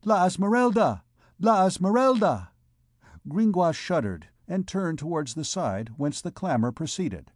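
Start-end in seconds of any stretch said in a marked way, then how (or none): 0.0-2.5 high, projected shout of a name; crowd cry. A narrator's reading. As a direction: call it out as a rising clamor. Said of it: "a cry arose among the thieves".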